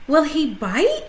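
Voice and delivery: put-on a nervous child asking about the hamster. A narrator's reading. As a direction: ask it worried, small. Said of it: "Phoebe asked nervously".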